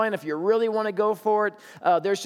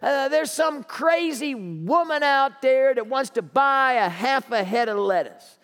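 put-on higher, slow, incredulous young clerk; a stock boy dragging it out in disbelief to his boss; flustered stock boy; 4 semitones higher than usual. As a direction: clerk complaining to his manager about a crazy customer, higher and slow. Stock boy reports crazy customer.

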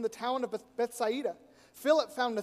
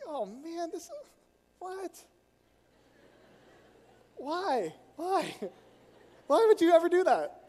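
rising, incredulous why, why, building to a loud cry; shocked disbelief; 7 semitones higher, 5 dB louder, slower than usual. Retold or imitated. To the leaders about the cross walk, rising, loud shocked disbelief. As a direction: pile on the disbelief until it bursts out.

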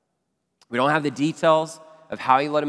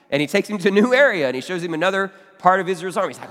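ordinary talk, nothing marked

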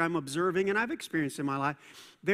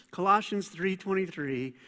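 ordinary talk

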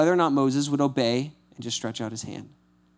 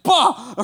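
mock startled yelp, high and loud; hammed-up fright yelp with a laugh; 11 semitones higher, 6 dB louder than usual. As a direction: jump back from a snake with a yelp. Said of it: "he's freaking out".

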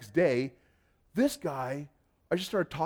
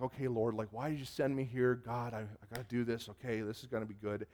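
lower, trailing nervous muttered prayer; retold uneasy prayer, getting softer; mildly nervous self-talk; 4 semitones lower, 6 dB quieter than usual. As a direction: talk yourself into it under your breath. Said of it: "getting kinda nervous and kinda like".

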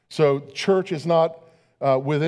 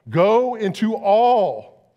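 commanding proclamation; 5 semitones higher, 5 dB louder, much slower than usual. Acted. Quoting Jesus's command to congregation.

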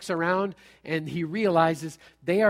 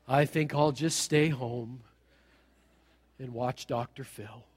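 lower, slow, soft mopey voice of a fearful churchgoer; timid worried believer; 5 semitones lower, 5 dB quieter, slower than usual. Pretend. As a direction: sigh it out like someone scared of everything. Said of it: "we're not supposed to walk out there like".

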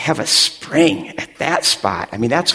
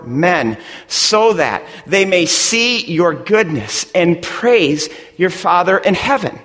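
preaching; 6 semitones higher than usual. Acted. Pastor driving the point home to his congregation, preaching. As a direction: preach it to the room with growing force.

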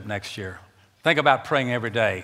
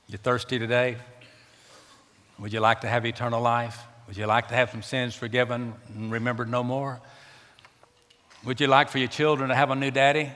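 ordinary delivery

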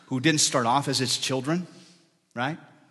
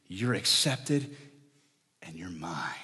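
tender and soft; 5 semitones lower, 4 dB quieter, slower than usual. Acted. God speaking tenderly to listeners.

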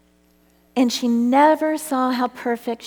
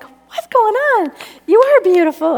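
amazed exclamation; 10 semitones higher, 8 dB louder than usual. Retold or imitated. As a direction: cry out in disbelief. Astonished at repeated compliments.

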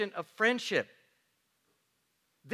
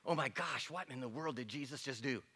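low, softer shocked mutter; scandalized murmur; 4 semitones lower, 13 dB quieter than usual. Retold or imitated. Crowd muttering about Jesus, hushed scandal. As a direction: grumble it under your breath. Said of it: "they were saying".

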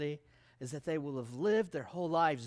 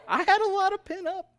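much higher, laughing; laughing squeal; 11 semitones higher, 7 dB louder, faster than usual. Real, not acted. Preacher admits his eagerness, laughing high.

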